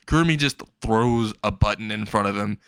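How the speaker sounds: usual speaking voice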